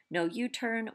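usual speaking voice

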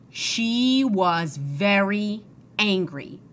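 "very angry" drawn out slowly for weight; very slow, heavy stress on "very angry"; slow emphasis. A narrator's reading. Narrator dramatizes rising anger.

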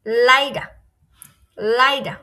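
"লাইডা" said loud with an "ai" glide. Shown as a demonstration. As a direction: model "লাইডা" as "lai-da" for learners. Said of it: "আয়ের মতো উচ্চারণ করবো".

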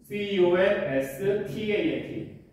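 letters C O N S T A N T spelt slowly one by one; spelling out slowly. Shown as a demonstration. Teacher spells the word for the class, slow dictation. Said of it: "상수를 영어로 뭐라 그래요?".

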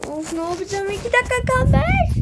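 high, panicky cry of alarm; panicked. Acted. Fan late for the match, panicked high cry. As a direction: squeal it like time is running out. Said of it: "çok panik oldum".

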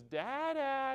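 put-on high, drawn-out little-kid call; proud little girl. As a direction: imitate a small child calling dad. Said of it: "she was all proud of the way that she looked".

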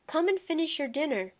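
high-pitched summons to the table; father calling to another room. A narrator's reading. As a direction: call a child back to dinner. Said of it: "my father called".

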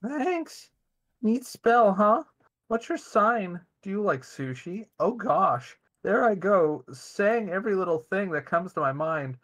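high breathy flirt; sexy voice. Acted. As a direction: coo it like a giddy flirt. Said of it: "Do you wanna do the sexy voice?".

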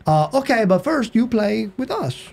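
high, slow put-on Mexican accent; hammed-up Spanish-accented English; bad Mexican accent. A college guy mimics the Mexican soccer player's accent. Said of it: "I've got a terrible accent".

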